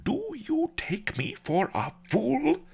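high, slow affronted retort; indignant, high-pitched old master; snapping. A narrator's reading. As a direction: snap it in wounded dignity. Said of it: "he snapped".

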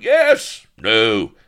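loud, high pirate shouts; cries from the crowd. A narrator's reading. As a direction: shout out rival votes from the rabble. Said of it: "There were cries of".

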